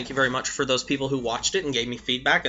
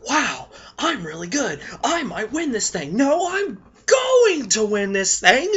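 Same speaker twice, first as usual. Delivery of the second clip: cocky player; 9 semitones higher than usual. Pretend. A winning player getting cocky, hammed-up boast. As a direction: puff yourself up, boasting more with each line. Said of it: "but then they get cocky".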